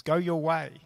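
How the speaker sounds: Naomi's plea read from the pulpit, swelling then falling; impassioned scripture reading. Acted. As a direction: build the plea, then let it sink. Reading scripture aloud, building.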